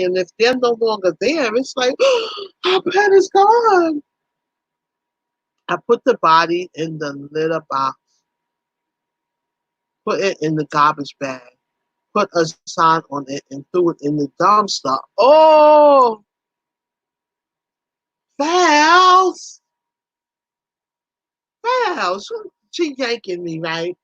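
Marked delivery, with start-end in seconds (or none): 2.0-2.6 mock gasp. Mock grief over lost pet. 2.7-4.0 mock grief. Mock grief over lost pet. 15.2-16.2 loud, high startled oh; sudden shocked shout; shocked cry. Real, not acted. Shock at cat in dumpster. 18.4-22.3 calling out. Real, not acted. Calling out to chatter.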